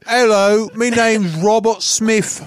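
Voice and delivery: loud, high Cockney caricature; silly high-pitched Cockney. Acted. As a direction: belt it in a hammed-up Cockney accent. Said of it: "He's a Cockney apparently now".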